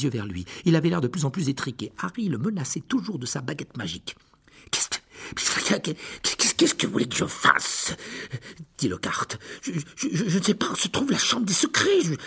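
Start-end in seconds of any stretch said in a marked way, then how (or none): 4.7-7.9 breathy, stammering fear; panicked stammer. Acted. Disarmed Lockhart stammers at Harry's wand, frightened. 9.6-12.1 breathy, stammering fear; panicked stammer. Acted. Disarmed Lockhart stammers at Harry's wand, frightened.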